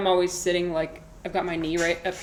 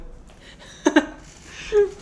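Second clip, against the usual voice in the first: high squeaky laugh; 14 semitones higher, 6 dB louder than usual. Real, not acted. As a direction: giggle up high. Laughing at own joke.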